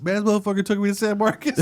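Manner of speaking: laughing while quoting his buddy. Retold or imitated. Retelling his buddy's day, laughing.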